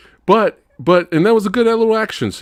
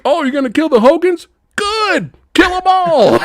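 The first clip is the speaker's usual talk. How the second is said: much higher, louder, cackling delight; gleeful uncle; 5 dB louder than usual. Retold or imitated. Uncle cheering the hero's plan, high gleeful shout. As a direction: shriek the approval with manic glee. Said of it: "he's all like".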